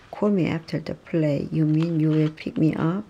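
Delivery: ordinary delivery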